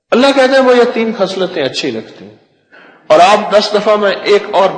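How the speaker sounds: high, loud proclamation; preaching at full force. Acted. Preacher proclaiming God's words to the congregation, loud. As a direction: proclaim it to the crowd with fervour.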